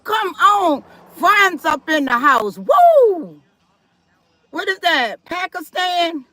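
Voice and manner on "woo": high whooping cheer; excited woo. Real, not acted. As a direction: whoop it up for the chat. Cheering a country in chat.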